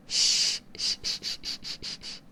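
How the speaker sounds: gentle repeated shushing to calm them; soothing shushing. Acted. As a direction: witch calming her panicking familiar with a whispered hush. Shushing the familiar again.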